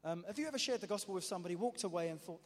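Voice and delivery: ordinary delivery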